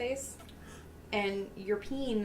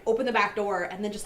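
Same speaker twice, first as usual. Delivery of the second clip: usual speaking voice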